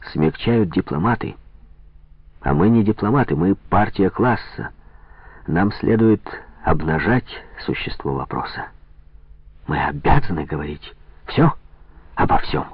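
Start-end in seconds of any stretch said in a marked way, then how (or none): none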